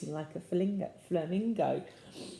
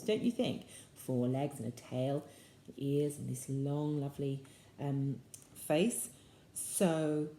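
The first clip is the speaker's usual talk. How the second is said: ordinary delivery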